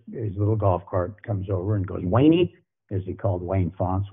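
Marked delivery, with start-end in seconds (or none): 2.0-2.5 high raised call across the practice field; imitating a coach calling a name, raised and high. Head coach summons his secondary coach, high raised call.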